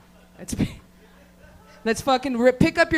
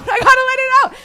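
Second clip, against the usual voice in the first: much higher and louder, fast, laughing through it; laughing outburst; 10 dB louder, faster than usual. Acted. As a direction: let it burst out in a laughing squeal. Repeats it, laughing and breaking up.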